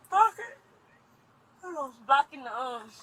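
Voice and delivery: a sudden pained yelp of frustration; distressed outburst. Real, not acted. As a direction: cry it out high and loud. Sudden distressed outburst mid-story.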